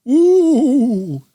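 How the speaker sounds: high, loud hooting owl; owl shouting. Acted. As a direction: hoot it out loud and high to scare. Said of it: "flapped his wings and shouted".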